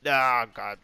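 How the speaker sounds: sudden loud groan of frustration; exasperated outburst. Real, not acted. Player hit by a setback mid-round, exasperated outburst. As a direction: burst out in dismay.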